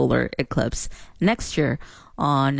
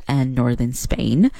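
ordinary talk, nothing marked

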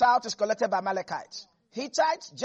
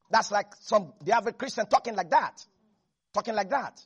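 normal talking voice

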